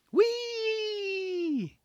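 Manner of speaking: put-on very high, slow, squealing kid-on-a-ride voice; giddy Clive. Clive shouting to kids on the rail, high slow squeal. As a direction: squeal it like a child on a merry-go-round. Voicing Clive's merry-go slide.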